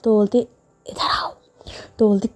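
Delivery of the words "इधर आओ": quiet beckon. Acted. Secretive beckoning.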